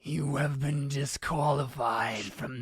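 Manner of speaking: slow, solemn, ominous sentencing; menacing old man. Acted. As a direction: pronounce a disgrace with grave, stretched words. Ernest pronounces judgement on Paul.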